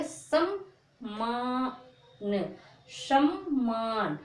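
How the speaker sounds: सम्मान drawn out slowly, the half म doubled into मान; सम्मान said slowly as an example word for the class; slow teaching demo. Shown as a demonstration. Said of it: "किस प्रकार से?".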